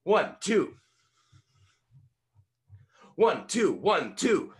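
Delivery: high, loud boxing count, each number hit hard; punched count. Shown as a demonstration. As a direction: call it out like a boxing trainer.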